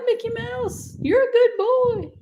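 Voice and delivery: very high, bright praise for a good dog. Shown as a demonstration. Trainer demonstrates the praise tone for a dog, very high. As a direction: squeal it up high like praising a puppy. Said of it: "The praise tone".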